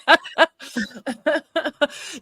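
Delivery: bright burst of laughter; a hearty real laugh. Real, not acted. Shared laughter after the racist quip.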